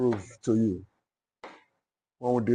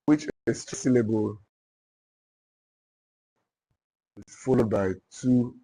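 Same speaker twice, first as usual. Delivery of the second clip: deliberate dictation, long pauses between phrases. Real, not acted. Teacher dictating the dactylic foot to note-takers, dictation. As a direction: dictate it in small repeated chunks for note-takers.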